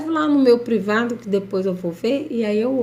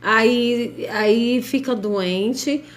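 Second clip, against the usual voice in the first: ordinary delivery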